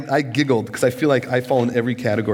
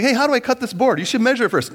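mock dialogue; 9 semitones higher, faster than usual. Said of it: "It's like".

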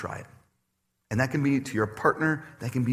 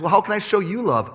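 higher, louder gentle wife voice; wife asking him, higher and lighter; quoting his wife; 8 semitones higher, 6 dB louder than usual. Retold or imitated. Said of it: "And she goes".

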